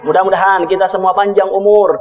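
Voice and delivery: low, even sermon benediction; closing prayer. Real, not acted.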